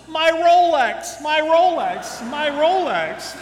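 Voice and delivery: high, loud, slow hammed-up lament; anguished wailing. Armless crash victim mourns his Rolex, loud slow hammed-up wail. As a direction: stretch out each cry in mock despair. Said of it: "he's screaming and he's crying".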